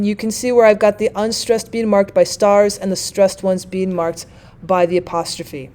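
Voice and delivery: ordinary delivery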